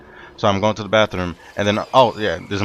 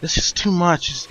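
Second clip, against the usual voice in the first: higher-pitched mock distraught voice; imitating crying; 7 semitones higher than usual. Retelling the female manager's breakdown at work, imitated crying. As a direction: imitate someone overwhelmed and tearful. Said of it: "she's, like, crying and bawling".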